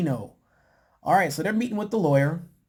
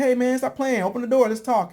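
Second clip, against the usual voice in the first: raised, high-pitched imitation of urging; mock urgent appeal; 7 semitones higher, 3 dB louder than usual. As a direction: imitate someone calling through a closed door. Acts out man pleading through door.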